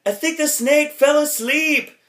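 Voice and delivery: high, slow put-on voice; quoting the movie line. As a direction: lift way up and slow it down like a movie character. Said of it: "The one that".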